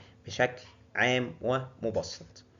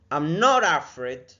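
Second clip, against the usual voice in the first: slow, higher and louder NOT; stress on NOT; 3 semitones higher, 8 dB louder, much slower than usual. Shown as a demonstration. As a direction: push the word not. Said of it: "teacher models a phrase". (تشديد على not)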